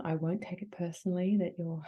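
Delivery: ordinary talk, nothing marked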